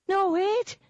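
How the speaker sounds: high, loud squeaky leprechaun cry; frantic little leprechaun yelling wait; leprechaun shouting. A narrator's reading. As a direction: shout it in a tiny high creature voice. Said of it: "the leprechaun shouted".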